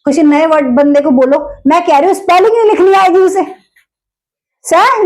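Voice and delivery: usual speaking voice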